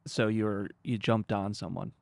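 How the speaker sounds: ordinary delivery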